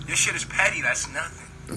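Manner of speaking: ordinary talk, nothing marked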